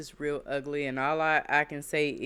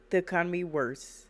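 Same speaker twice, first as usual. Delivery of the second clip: normal talking voice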